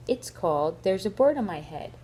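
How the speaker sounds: ordinary delivery, nothing marked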